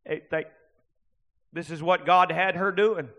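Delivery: ordinary talk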